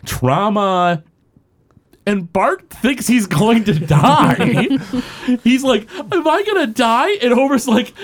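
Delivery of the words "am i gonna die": put-on high, small kid voice asking; lightly voiced frightened boy; Bart's voice. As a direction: squeak it out like a scared kid. Retelling Bart's scared question.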